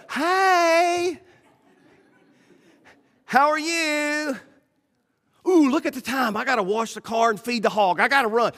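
hammed-up polite excuse to leave; fake friendly escape. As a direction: brush them off with fake politeness. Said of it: "you go".

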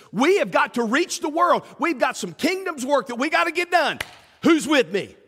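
rally the troops; rallying call. Retold or imitated. Jesus calling followers to reach the world, rallying. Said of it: "He says".

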